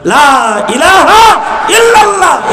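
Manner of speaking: slow, high shout of the creed; ringing proclamation. Real, not acted. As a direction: proclaim it loudly, stretching each word. Preacher proclaims the creed to crowd. (উচ্চস্বরে টেনে টেনে কালিমার ঘোষণা)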